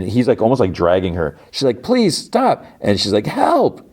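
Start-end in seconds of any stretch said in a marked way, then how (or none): 1.8-2.6 very high imitated pleading; acted-out distress; alarmed plea. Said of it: "hear Helly craw-, call out". 3.3-3.9 very high mock cry for help; retold yelp for help; calling out. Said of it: "call out".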